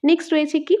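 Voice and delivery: ordinary delivery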